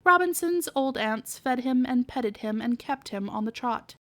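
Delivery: usual speaking voice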